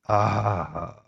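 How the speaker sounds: long, drawn-out sighing "ah"; imitated sigh that slowly lets go; relieved sigh. Retelling the week finally being over, slow sigh of relief. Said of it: "those times when you feel like".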